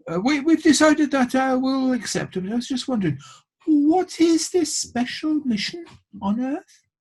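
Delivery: put-on a shy girl asking the ruler a question; young girl Eva. Eva's voiced lines to the ruler.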